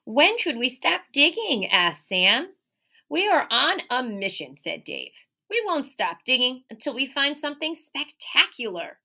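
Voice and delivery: lively, expressive read-aloud; animated picture-book reading; reading to children. Acted. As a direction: read it like storytime for little kids. Teacher reads picture book aloud.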